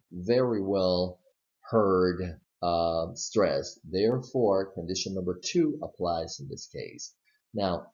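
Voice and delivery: usual speaking voice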